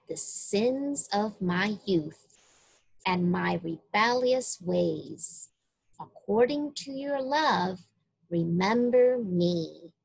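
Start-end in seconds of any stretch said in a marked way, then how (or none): none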